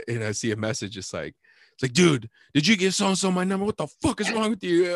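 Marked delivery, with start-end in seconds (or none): 1.9-4.9 higher, louder irate outburst; mock angry text. Friend reacts to getting his number given out, mock angry. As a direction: read an all-caps message as outrage. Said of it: "all mad, you know, all caps".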